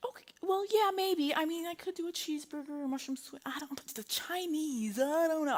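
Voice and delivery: high, softening indecisive ramble; wavering reluctance. Retold or imitated. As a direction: start high, trail softly into doubt. Acting out wavering over burger suggestion.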